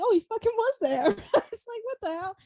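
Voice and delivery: it just hit you, squeal it out; shocked realization. Retold or imitated. Realizing [name] really came.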